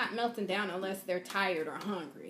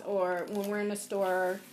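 ordinary delivery

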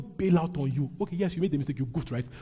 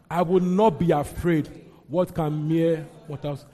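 slow, loud congregational recitation; leading scripture reading; 5 dB louder than usual. Acted. Preacher leading the congregation through a verse, slow loud declamation. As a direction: read out boldly, each phrase drawn out.

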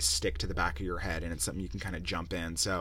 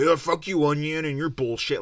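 mock screaming at an onion; 6 semitones higher, 6 dB louder than usual. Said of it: "just screaming".

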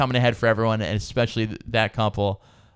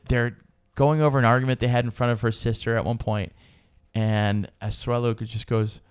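normal talking voice

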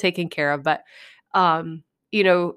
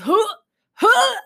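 high, strained mock gag sounds; imitating gagging; 14 semitones higher, 4 dB louder than usual. Mimicking squeamish friend gagging over dead bird, high retching huffs. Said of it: "Danielle is gagging and she's just".

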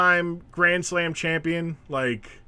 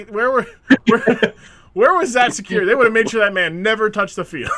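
louder, higher mock indignation; mock outrage; 5 semitones higher, 6 dB louder than usual. Real, not acted. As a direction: demand it like an outraged fan. Indignant joke about security.

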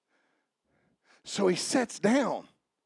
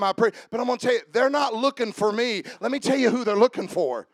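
ordinary talk

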